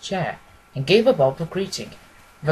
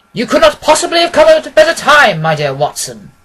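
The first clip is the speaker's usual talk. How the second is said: a louder, higher Holmes voice greeting warmly; cordial Holmes; 9 semitones higher, 11 dB louder than usual. A narrator's reading. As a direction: welcome your old friend in with open arms. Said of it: "he said cordially".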